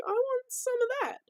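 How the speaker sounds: high eager leering voice; Zeus eyeing another man's wife. Retold or imitated. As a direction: want it greedily. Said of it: "Zeus was like".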